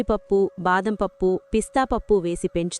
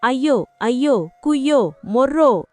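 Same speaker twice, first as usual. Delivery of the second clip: higher, hammed-up lament; storyteller's mock wail, pitched up; drawn-out wailing; 4 semitones higher, 5 dB louder than usual. A narrator's reading. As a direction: draw out each cry of woe. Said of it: "అంటూ దీర్ఘాలు తీస్తుంటే".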